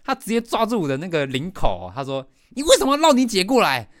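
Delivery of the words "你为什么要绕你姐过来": much higher, louder furious shout; crying angry yell. Retold or imitated. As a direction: yell through tears. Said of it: "一直对我大吼大叫". (边哭边大吼质问)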